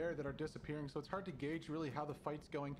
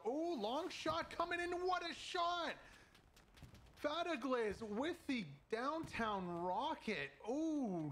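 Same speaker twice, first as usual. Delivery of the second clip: excited; 8 semitones higher, slower than usual. Real, not acted. Caster calls a great shot.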